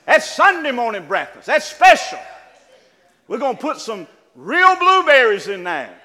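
loud, driving sermon build to the congregation; preaching at full force. Acted. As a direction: preach it out loud, pushing the church to get ready.

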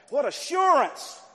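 high, loud, slow cry; rising preacher's shout; preaching shout. Real, not acted. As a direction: hold and ring out assurance. Preaching climax.

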